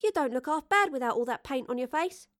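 chirpy teasing. Acted. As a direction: chirp it as a friendly tease. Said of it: "Astrid chirped".

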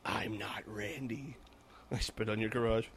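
low, soft, slow menacing spoof; hushed mock horror villain; spoofed movie voice.

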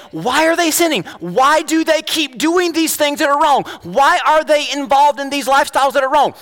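high-pitched exasperated questioning; hammed-up judgmental indignation; impatient and judgmental. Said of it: "we become instantly impatient and judgmental".

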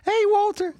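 very high cartoon squeak; Perry. One cartoon sibling greets the other, high squeaky voice. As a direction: call out in a squeaky little cartoon voice. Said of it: "they talk like this".

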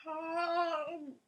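loud, slow drawn-out call; booming call. Acted. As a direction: call it out grandly. Calling out a hammy greeting.

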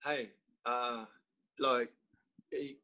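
put-on a light Australian accent, drawn out slowly at the end; a neighbour's casual warning in a faint Australian accent; slight Australian accent. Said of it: "you went a little Australian there".

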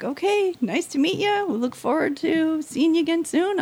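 lightly acted cheery brush-off; polite send-off. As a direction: imitate a pleasant, noncommittal goodbye. Imitates writers' polite send-off.

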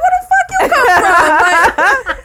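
high, loud retort cracking into laughter; laughing retort. Retold or imitated. Retelling her retort to husband's friend, laughing hard. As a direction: fire it back while laughing.

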